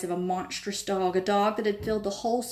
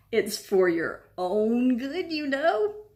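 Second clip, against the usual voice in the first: high, slow false sweetness; mock concern with a sly edge; wicked poltergeist; 4 semitones higher, 3 dB louder, much slower than usual. A narrator's reading. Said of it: "eyes glittered wickedly".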